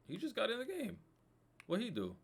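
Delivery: high, disbelieving question; can't believe the booking; incredulous. Real, not acted. Shocked at the yellow card.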